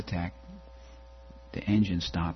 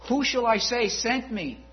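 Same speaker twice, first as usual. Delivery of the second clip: Moses asking. Retold or imitated. Moses questioning God.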